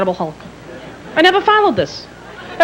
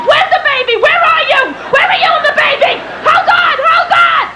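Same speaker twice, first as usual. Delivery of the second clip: very high-pitched frantic yelling; frantic panic; 13 semitones higher, 3 dB louder than usual. Retold or imitated. Woken sister to caller about baby, panicked shrieking. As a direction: shriek as if jolted awake into an emergency.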